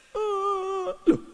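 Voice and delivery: high, fast voiced widower; mock surprised widower. Preacher voicing widower to weeping friends, high mock surprise. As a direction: play the puzzled husband.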